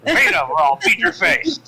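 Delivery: high, loud mock strong-arming; mock threat. Joking with the table about strong-arming the orphanage, mock threat.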